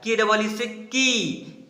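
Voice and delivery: की stretched with a long ee, slow; long kii. Shown as a demonstration. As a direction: draw out की to a long ee. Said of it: "बड़ा उच्चारण होगा क्योंकि बड़ी ई की मात्रा है". (बड़ी ई की मात्रा वाला लंबा की)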